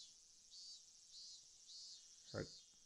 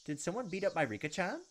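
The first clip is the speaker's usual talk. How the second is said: louder, higher, rising in alarm; alarmed outburst; 5 semitones higher, 5 dB louder than usual. Real, not acted. Player sees Rika hurt, alarmed and louder. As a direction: blurt it out, shocked and protective.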